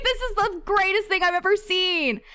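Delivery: amused imitation of Linus, laughing; laughing Linus quote. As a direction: giggle out his delight up high. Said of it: "he's like".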